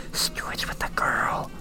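slow, breathy stage whisper. A narrator's reading. Dory tells Addy the gossip in a whisper. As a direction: hush it like a secret. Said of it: "she whispered in a voice".

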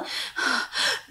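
imitating a huffing angry breath, unvoiced and soft; angry heavy breathing. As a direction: huff out air like someone fuming in the dark. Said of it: "ai mà đang thở". (tiếng thở phì phì giận dữ)

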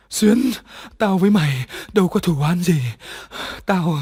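put-on girl pleads with her dead friend's ghost; pleading in fear. A narrator's reading. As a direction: beg the ghost, terrified. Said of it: "Hường sợ điếng hồn vía".

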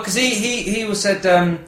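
ordinary delivery

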